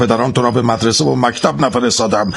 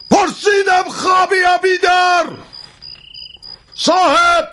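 shouting. Acted. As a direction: yell threats across the rooms. Calling to sleepers, threatening.

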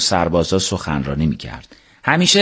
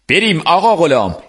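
higher, lightly acted call to leave; lightly voiced character; 9 semitones higher, 3 dB louder than usual. A narrator's reading. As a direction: call him along in a slightly raised character voice. Mirza Vali calls Gholam to go.

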